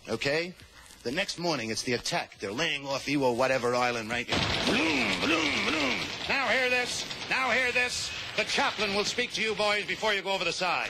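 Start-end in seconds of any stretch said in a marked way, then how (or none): 4.7-6.0 high, long horn blasts bloom bloom; mock foghorn bloom. Comic imitating a warship horn. 6.3-10.9 high, nasal-free PA call now hear this; loudspeaker announcer. Acted. Comic voicing the ship's PA before the landing.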